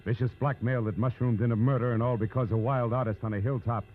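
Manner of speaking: hard-boiled narrator delivering the moral; dramatic radio narration. Acted. Opening crime moral and teaser.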